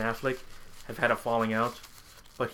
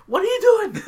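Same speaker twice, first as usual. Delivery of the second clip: much higher and louder, a squealing disbelief; incredulous outburst; 9 dB louder than usual. Retold or imitated. Yelling at the movie's bad joke in high disbelief. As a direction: shriek it at the screen. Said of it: "I'm like".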